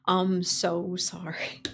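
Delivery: slow, breath-only whisper; hushed apology. Real, not acted. Streamer apologizing to viewers for broken toggles, whispered. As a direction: whisper it slowly, drawn out.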